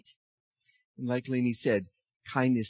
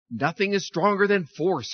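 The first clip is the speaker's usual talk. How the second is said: loud, higher howl of the wind; 6 semitones higher, 6 dB louder than usual. A narrator's reading. Wind boasting to the sun, loud howl. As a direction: boom it out like a blustering wind. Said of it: "howled the wind".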